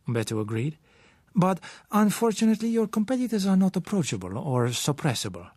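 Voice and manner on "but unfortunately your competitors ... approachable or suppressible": lightly voiced suave foreigner, higher; a hint of a man who knows he holds the cards; higher-pitched hint of a sure Latin trader. A narrator's reading. Umberto confident claim.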